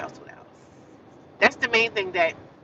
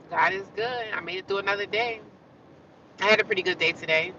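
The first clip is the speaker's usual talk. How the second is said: ordinary talk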